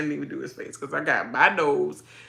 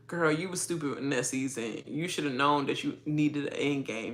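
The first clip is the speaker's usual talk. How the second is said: ordinary talk, nothing marked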